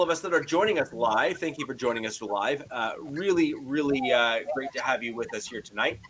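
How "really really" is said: ordinary talk, nothing marked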